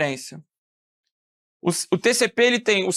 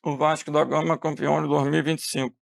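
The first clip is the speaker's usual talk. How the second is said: quieter, lower, smeared words like late-arriving audio; garbled delayed call; 5 semitones lower, 4 dB quieter than usual. Shown as a demonstration. Teacher showing how horrible late-arriving syllables would sound, quieter and smeared. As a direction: mumble it like syllables arriving out of order. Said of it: "horrível seria se eu falasse assim".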